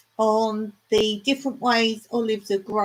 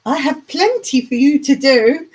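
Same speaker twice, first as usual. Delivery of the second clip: much louder, higher declaration; emphatic retold reply; 5 semitones higher, 9 dB louder than usual. Gardener retelling her offer of work to a volunteer, loud and emphatic. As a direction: announce it loudly, pitch raised.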